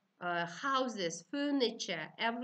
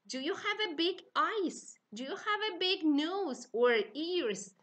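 put-on higher, lively question to children; asking the class; 7 semitones higher than usual. Shown as a demonstration. Teacher asking young learners about body parts, higher and bright. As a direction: ask it like you're delighted to find out. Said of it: "Try to show your body and say".

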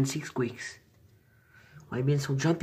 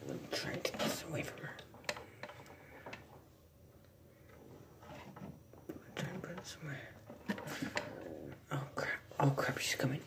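whispering; 8 dB quieter than usual. Real, not acted. Whispering near skittish pet.

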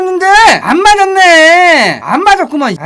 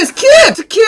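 frustrated shout; 9 semitones higher than usual. Real, not acted. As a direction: yell at the screen to skip. Shouting at skip not working. (짜증 섞인 고함)

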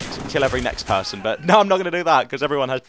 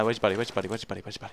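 repeated, dropping to a hush; fading to hush; 5 semitones lower, 8 dB quieter, much faster than usual. Real, not acted. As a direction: trail off softer each time. Sneaking up on enemy.

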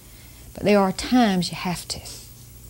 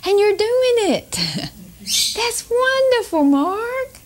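put-on much higher, gushing praise for a child; glowing praise; 12 semitones higher, slower than usual. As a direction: lift the voice high as a mother delighting in her boy. Mother praising her little boy.

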